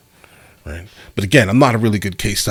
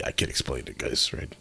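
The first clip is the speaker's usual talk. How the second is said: whispered aside; 8 semitones lower, 12 dB quieter than usual. Real, not acted. Embarrassed aside to viewers.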